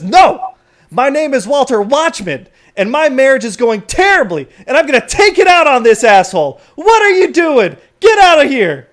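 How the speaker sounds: high, very loud ranting; angry yelling. Acted. Watchman takes bad marriage out on stranger, yelling. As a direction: blow up at a bystander at full volume. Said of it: "he starts yelling at you and at the crowd".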